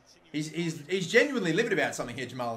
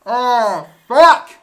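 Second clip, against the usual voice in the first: frustrated swearing; 4 semitones higher, 15 dB louder than usual. Real, not acted. Fan watching the opponents score, frustrated cursing. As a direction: groan rising into a loud curse.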